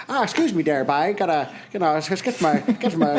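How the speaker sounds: mock Newfie radio-personality voice; Newfoundland accent. Radio personality squeezing past on a plane, in mock Newfoundland accent. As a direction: imitate a chatty Newfoundlander with a put-on accent. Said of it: "hails from the Rock".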